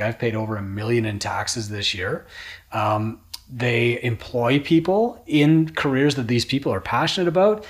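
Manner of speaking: usual speaking voice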